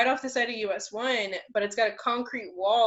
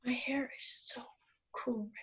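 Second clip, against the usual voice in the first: soft whisper about her hair; 2 semitones higher, 12 dB quieter than usual. Real, not acted. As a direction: breathe it out as a whisper.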